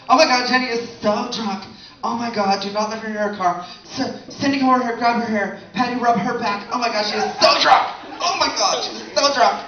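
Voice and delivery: put-on high, fussing girly voice; mock panicked girlfriend. Comic mimicking girls fussing over a drunk friend, high and panicky. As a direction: ham up a worried friend.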